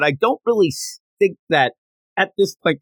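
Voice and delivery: usual speaking voice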